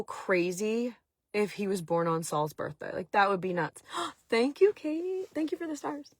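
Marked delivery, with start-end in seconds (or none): 4.1-6.1 gasp, then high-pitched thanks; real pleased surprise, pitched way up; delighted thanks. Real, not acted. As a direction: thank a viewer in a high, softening voice. Thanking a live viewer for gift.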